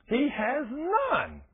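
He has "none", slow and high with none stretched; stress on none. Shown as a demonstration. Preacher driving home to congregation, slow stressed "none". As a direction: drag out "none" slowly, high.

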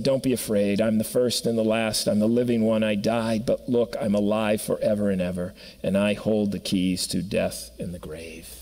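calm him, don't be afraid; tender low comfort from Jesus to John; gentle reassurance. Acted.

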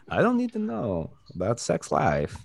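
put-on high-pitched, weird foreign-accented movie one-liner; stilted mock accent, forced comeback; forced Van Damme accent. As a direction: do an awkward action-star impression that drifts toward Tommy Wiseau. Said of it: "sounds like Tommy Wiseau".

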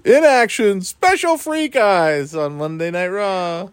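hyped ring announcer voice; wrestling announcer. Pretend.

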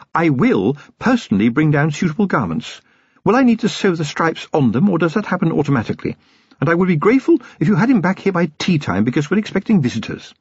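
high-pitched lady of the house, politely imperious; swooping high voice of a self-assured wife; gracious, formidable lady. A narrator's reading. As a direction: social niceties that build to a challenge.

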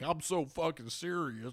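exaggerated, slow parody of seriousness; mocking LeBron James. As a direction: drag it out in mock self-importance. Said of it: "But with LeBron James, he's like".

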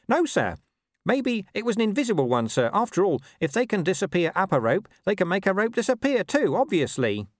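high-pitched, eager underling voice; placating corporal. A narrator's reading. Corporal saluting and explaining to his captain, placating.

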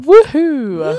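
loud, high whoop; excited cheer. Real, not acted. Cheering as the show wraps up, excited whoop. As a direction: whoop it out brightly.

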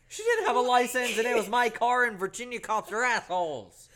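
high and loud, laughing; exasperated laughing rant; loud laughing explanation. Real, not acted. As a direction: defend yourself while laughing.

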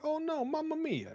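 very high cartoon Italian wail; mock Italian accent. Host plays Mario in dismay, hammed-up Italian accent. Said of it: "you're not Italian at all".

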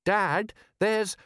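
high-pitched, interrupted warning; young son. Acted. Son tries to warn father.

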